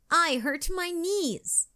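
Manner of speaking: high, slow little complaint; lightly acted woe, drawn out and high; sighed complaint. A narrator's reading. Katie has slipped and fallen down, high sighing complaint. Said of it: "Katie sighed".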